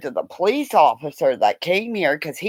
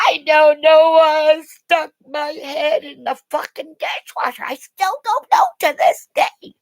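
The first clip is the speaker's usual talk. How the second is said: upset reply; 9 semitones higher than usual. Retold or imitated. Her own retold answer, upset.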